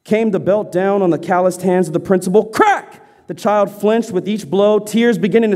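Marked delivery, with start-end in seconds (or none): 2.4-3.0 high, punchy sound-effect crack; sharp crack cry. A narrator's reading. Narrator voices belt striking hands, high sharp crack. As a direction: snap the word out like a whip.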